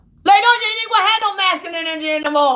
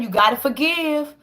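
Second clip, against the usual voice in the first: usual speaking voice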